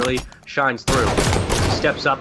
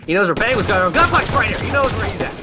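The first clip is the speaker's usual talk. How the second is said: ramp up as the fight starts; excited caster; 7 semitones higher than usual. Real, not acted. Caster calling the action.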